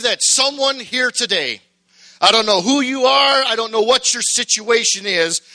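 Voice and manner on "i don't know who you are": ordinary talk, nothing marked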